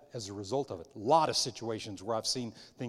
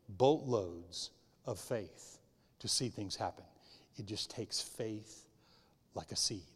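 softer and slower, drawing it out; soft slow emphasis; 4 semitones lower, 7 dB quieter than usual. Real, not acted. A preacher reassuring the congregation, soft and slow. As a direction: lean in and say it gently.

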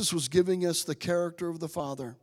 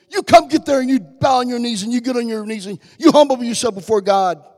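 loud, high driving preach; full-throated sermon climax; fervent preaching shout; 5 semitones higher, 11 dB louder than usual. Acted. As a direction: hammer it home with force. Mocking harsh religious demands.

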